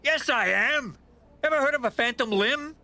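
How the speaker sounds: ordinary delivery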